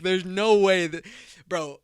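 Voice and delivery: ordinary talk, nothing marked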